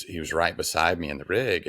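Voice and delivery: usual speaking voice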